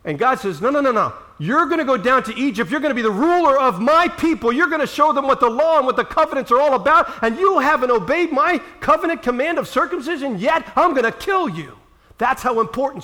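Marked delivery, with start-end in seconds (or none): 0.6-11.8 raised, booming divine threat; God's stern warning. Acted. God warning Moses, loud and stern.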